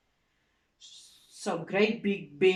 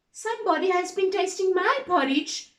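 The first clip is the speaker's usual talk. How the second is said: much higher, quick mama bear; higher, faster mother bear; middle-sized bear voice; 10 semitones higher, 5 dB louder, faster than usual. Acted. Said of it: "in her middle-sized voice".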